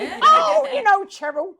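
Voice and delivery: high, loud, hammed-up mimicry; big mock-dismissive impression of a castmate; imitating Andy. Him brushing off her correction, loud high imitation. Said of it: "And he goes".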